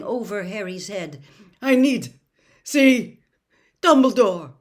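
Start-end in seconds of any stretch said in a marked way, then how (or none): 1.6-4.5 loud, higher, each word forced out; delirious man begging to be taken; strained plea. A narrator's reading. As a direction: struggle to get the words out. Desperate plea, great effort.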